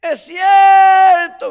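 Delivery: high, long-drawn full-force yell; shouting. Retold or imitated. Another churchgoer yelling agreement to the preacher, drawn-out shout. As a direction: holler it, stretching the words right out. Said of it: "gritaba otro".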